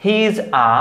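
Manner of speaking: "a" said ah, not ay; article as "aa". Shown as a demonstration. Teacher showing the article inside a sentence, "a" said ah. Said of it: "তখন আ বলতে হয়".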